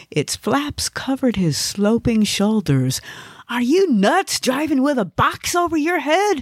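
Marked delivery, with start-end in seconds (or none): 3.5-6.4 high-pitched alarmed outburst; panicked scolding. Acted. Panicked passenger confronts driver.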